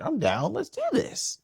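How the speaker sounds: high-pitched hyped friend; pumped-up acted-out yes; eager agreement. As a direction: imitate a buddy who's totally game.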